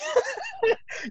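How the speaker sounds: squeaky laugh burst; real high laugh; high giggle. Real, not acted. Laughing at imagined scene.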